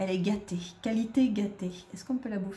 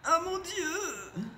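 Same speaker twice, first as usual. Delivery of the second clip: awed; 7 semitones higher, much slower than usual. Real, not acted. Seeing the Sim die.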